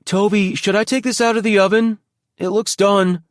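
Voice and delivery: put-on young man calling from the kitchen. A narrator's reading. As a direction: call it out across the house, light and casual. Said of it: "a familiar voice call out".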